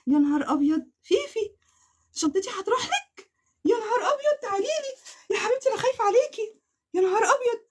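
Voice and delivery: high, tearful excited squeal. Real, not acted. Vlogger telling winner Fifi the bag is hers, tearful. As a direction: cry out half sobbing with joy.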